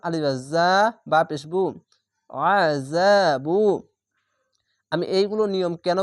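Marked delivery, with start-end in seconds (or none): 2.5-3.6 slow, drawn-out আযাবু from a tight throat; thick আ, then hold যা long in আযাবু; throat-squeezed ain. Shown as a demonstration. Teacher completing the spelling of আযাবু, slow measured chant. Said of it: "গলা চিপে মোটা করে পড়বেন".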